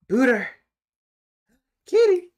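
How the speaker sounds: high, loud call across the room; calling the cat. Real, not acted.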